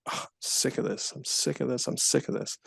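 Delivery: mock fed-up mumble, half under the breath; fed-up groan. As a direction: mutter it like you're sick of everything. Imitating listener fed up.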